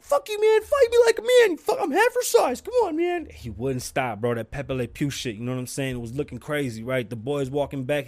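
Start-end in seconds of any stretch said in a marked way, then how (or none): 0.0-3.3 very high, loud squeaky little-man yell; squeaky little guy. Retold or imitated. Little homie challenging the big homie, very high loud squeak. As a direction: yap it up like a tiny guy picking a fight. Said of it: "Lil homie was like".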